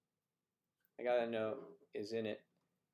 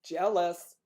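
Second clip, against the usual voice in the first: loud call; 6 semitones higher, 10 dB louder than usual. Acted. Calls out to someone.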